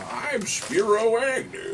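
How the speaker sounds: slow, lightly voiced politician; Spiro Agnew. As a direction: drag it out like an old politician. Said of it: "it's, uh".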